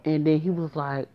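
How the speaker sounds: ordinary delivery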